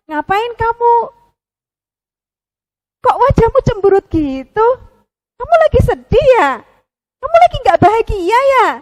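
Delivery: high, eager little friend voice; a chirpy puppet pal asking questions; curious friend puppet voice. Acted. Pupi the puppet friend asks the sad penguin, high and eager.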